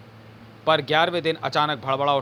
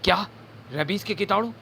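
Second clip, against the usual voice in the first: pitched higher in shock; shocked question; 6 semitones higher than usual. Acted. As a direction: ask it in alarm, rising high. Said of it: "धक्के से धड़क उठे दोनों के दिल". (चौंककर ऊँचे स्वर में सवाल)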